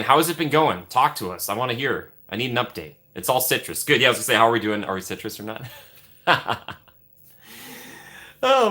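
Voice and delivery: ordinary talk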